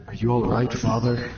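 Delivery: low confessor voice. Acted.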